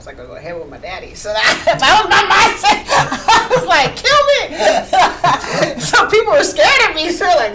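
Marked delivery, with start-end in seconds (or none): 1.8-7.2 "Kill me" said laughing, high and loud; laughing at her old reckless self as a kid. Retold or imitated. Laughing retelling of death wish.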